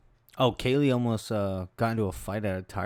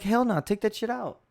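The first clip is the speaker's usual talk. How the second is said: much higher, outraged hell no; indignant refusal; 11 semitones higher than usual. Retold or imitated. Refusing the minivan dealer's add-ons, outraged and high. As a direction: throw it back at the salesman. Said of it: "And we're like".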